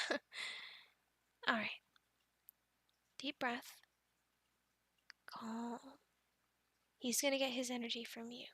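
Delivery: quiet and steady, easing them into calm; calming coaching. Acted. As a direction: settle a nervous beginner with a hushed voice. Coaching a calm approach to dragon.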